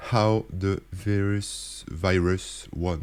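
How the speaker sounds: slow, drawn-out mock American accent. Reading the New York Times headline in a mock American accent. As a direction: read the English title in a put-on American accent, very slowly. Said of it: "J'essaie de prendre mon accent, d'américain".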